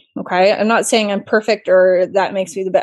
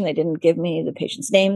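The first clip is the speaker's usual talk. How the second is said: normal talking voice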